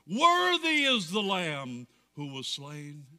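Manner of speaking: slow, loud proclamation. Acted. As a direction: cry it out grandly like a host of angels. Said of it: "saying with a loud voice".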